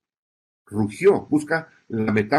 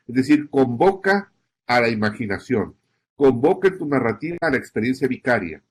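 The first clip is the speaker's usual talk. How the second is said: ordinary delivery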